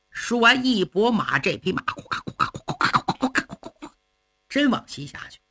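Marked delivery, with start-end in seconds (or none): 1.8-3.9 fast, whispered clip-clop of galloping hooves; hoofbeats imitated. Storyteller mimics the horse galloping away, fast and whispered. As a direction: rattle off the gallop under your breath.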